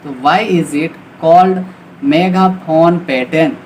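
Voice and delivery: normal talking voice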